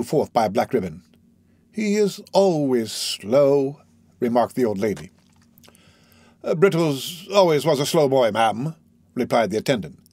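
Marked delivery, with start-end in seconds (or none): 1.7-3.8 put-on slow, measured elderly lady voice; drawn-out dignified old woman. Old lady remarks on Brittles. 6.4-8.7 a servant voice with a hesitant uh; reply like a deferential old attendant; mild put-on manservant voice. Giles replies to the lady.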